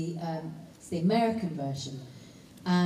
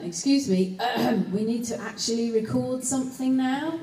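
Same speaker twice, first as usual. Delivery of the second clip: director's interruption; 5 semitones higher than usual. Retold or imitated. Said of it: "the director had to just keep".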